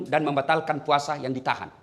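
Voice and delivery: ordinary delivery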